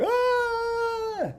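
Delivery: high imitated scream; mock the scream while free falling; high long mock scream. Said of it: "So I was screaming like".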